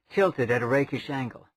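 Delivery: ordinary delivery, nothing marked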